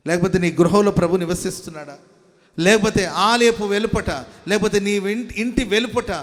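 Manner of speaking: loud, driving sermon delivery; preaching at full force. Acted. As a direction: preach it out to the whole congregation. Preacher asks the congregation searching questions. (బిగ్గరగా ఉద్రేకంతో ప్రసంగం)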